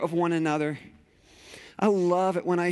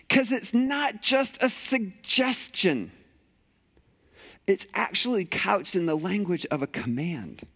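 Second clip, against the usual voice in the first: ordinary delivery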